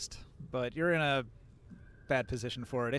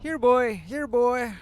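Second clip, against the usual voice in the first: loud, high call for a dog; calling a lost pet; 10 semitones higher, 9 dB louder, slower than usual. Acted. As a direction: shout out calling your dog.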